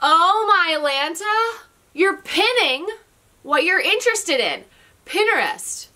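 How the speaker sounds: sudden delighted discovery; excited realisation. Real, not acted. Sudden realisation about the name.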